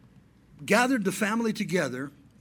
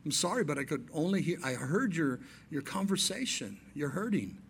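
1 gentle, soft offer; 2 semitones lower, 7 dB quieter than usual. Retold or imitated. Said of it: "just say".

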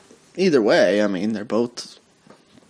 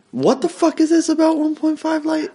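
high-pitched what the fuck; shocked disbelief. Real, not acted. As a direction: voice shoots way up in disbelief. Baffled at the headline.

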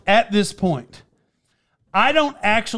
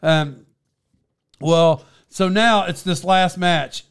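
ordinary talk

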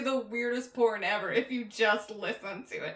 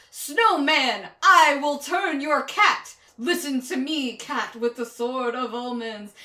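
louder, slow heroic declaiming; mock heroic Lion-O; 2 semitones higher, 8 dB louder, slower than usual. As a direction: proclaim like a cheesy cartoon hero. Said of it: "hear Lion-O be like".

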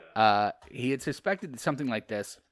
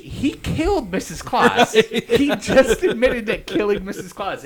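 high, loud and slow, laughing in disbelief; 10 semitones higher, 13 dB louder, slower than usual. Real, not acted. Reader to friends about the killer freed, laughing. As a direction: laugh out the absurd plot twist.

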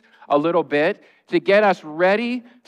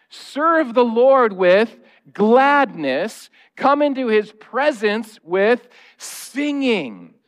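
scripture reading; 4 semitones higher, much slower than usual. Acted. Reads scripture aloud.

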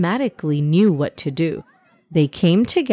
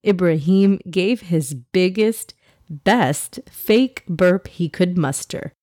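ordinary delivery, nothing marked

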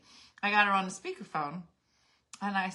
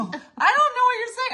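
much higher and louder, laughing through it; laughing disbelief; 9 dB louder than usual. Retold or imitated. Narrator baffled by Jurgen, laughing high and loud. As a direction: laugh out the confusion. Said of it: "And I go".

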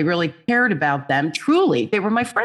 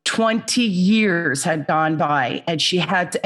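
ordinary delivery

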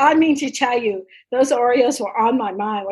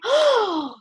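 high, crying out the name with a break of joy; delighted surprise; 7 semitones higher, much slower than usual. Retold or imitated. Mom discovering the Oreos, overjoyed high shout. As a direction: shout it like a kid who just found the prize. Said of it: "I'm like".

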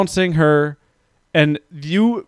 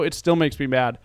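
ordinary delivery

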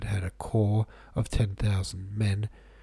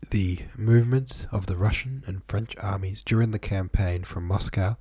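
ordinary delivery

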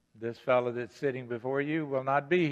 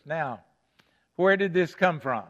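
usual speaking voice

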